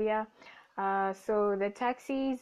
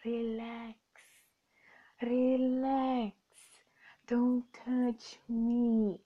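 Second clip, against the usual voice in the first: slow calm-down; 3 dB quieter, much slower than usual. Retold or imitated. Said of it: "And I'm like".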